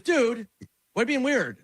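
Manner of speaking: high-pitched imitation of an indignant caller; exasperated retold retort. Retold comedian reacting exasperated.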